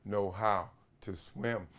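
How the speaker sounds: ordinary talk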